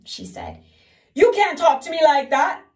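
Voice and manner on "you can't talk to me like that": high, loud sobbing protest; sobbing king. Acted. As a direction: sob it out, offended. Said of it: "sobbed the king".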